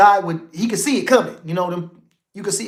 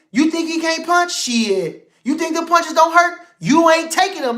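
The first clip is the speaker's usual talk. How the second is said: heated scolding; 7 semitones higher than usual. Real, not acted. Scolding critics who doubt punch.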